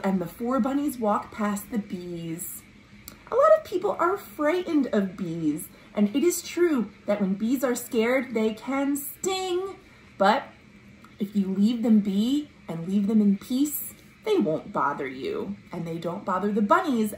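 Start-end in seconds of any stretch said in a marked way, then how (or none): none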